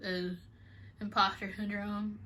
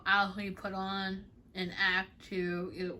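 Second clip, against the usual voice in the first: ordinary talk, nothing marked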